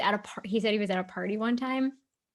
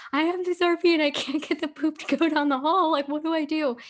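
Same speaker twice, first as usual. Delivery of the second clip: higher, louder pleading forum poster; desperate RV owner; 7 semitones higher, 6 dB louder than usual. Retold or imitated. RV owner asking the thread for help, exasperated plea. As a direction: voice a helpless camper begging for advice. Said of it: "so people are like".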